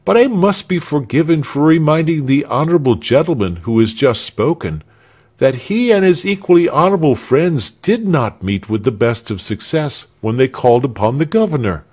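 mildly pompous orator; pompous citizen. Acted. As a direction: hold forth like a self-important townsman. Pompous citizen speech in story.

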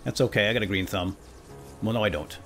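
usual speaking voice

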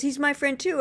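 imitating someone thrilled by a coincidence; retold excited discovery. Retold discovery of shared friend.

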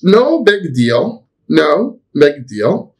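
drawn-out, careful phrase for students; slow model phrase. Shown as a demonstration. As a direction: say it slowly so they can copy. Teacher models phrase slowly. (عبارة نموذجية ببطء)

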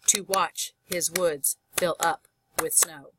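"to watch / his woods / fill up / with snow" in slow pairs with gaps; hoofbeat rhythm. Shown as a demonstration. Teacher shows horse's hooves in the poem, "to watch his woods fill up with snow" in paired beats. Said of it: "to imitate the sound of a horse's hooves".

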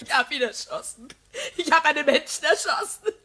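shaken confession. Acted. Widow confesses killing in shock.

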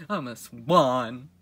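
grand slow proclamation. Acted. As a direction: announce it like a majestic swan.

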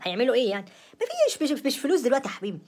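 high-pitched, loud dismissal; incredulous scoffing. Acted. Wife telling husband money buys nothing nowadays, incredulous. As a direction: shoot up high in exasperated disbelief.

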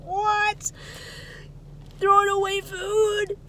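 high, slow disbelieving cry; can't believe they throw food away; incredulous what. Retold or imitated.

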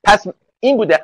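ordinary talk, nothing marked

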